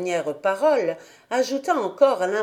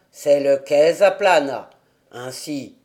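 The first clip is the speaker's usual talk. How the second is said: put-on lower, gruff old man; old grandfather; 6 semitones lower, 5 dB louder than usual. A narrator's reading. Grandfather answers Heidi about the flowers, lower old man's voice.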